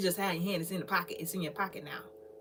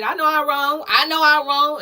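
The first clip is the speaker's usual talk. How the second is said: loud mock confession; 5 semitones higher, 10 dB louder than usual. Acted quote of someone admitting fault.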